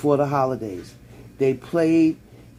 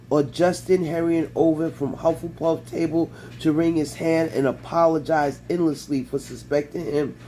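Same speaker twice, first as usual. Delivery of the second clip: usual speaking voice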